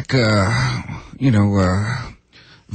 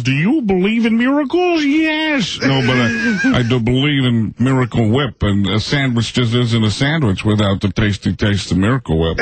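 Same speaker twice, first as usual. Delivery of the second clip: Al Michaels impression. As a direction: call it like a big-moment broadcaster. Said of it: "Al Michaels".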